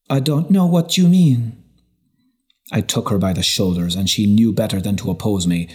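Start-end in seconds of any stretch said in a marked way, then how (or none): 0.1-1.6 higher, louder feigned innocence; defensive woman voice. A narrator's reading. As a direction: brush it off with a raised voice. Felicia denies, voiced by narrator.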